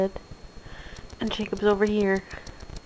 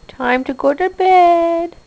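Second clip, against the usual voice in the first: sleepy talk to pet; 7 semitones higher, 9 dB louder, much slower than usual. Real, not acted. Telling pets bedtime.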